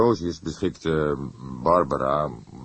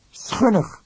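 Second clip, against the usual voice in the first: higher, clipped exclamation of disgust; disgusted young detective; 4 dB louder than usual. Acted. Young colleague condemning the prophet, disgusted exclamation. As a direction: spit out the judgment.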